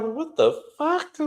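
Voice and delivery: high, slow stunned what the fuck; shocked. Retold or imitated. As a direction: react like you can't believe it. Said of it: "Letitia looking like".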